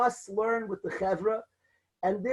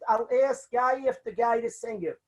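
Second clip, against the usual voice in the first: ordinary delivery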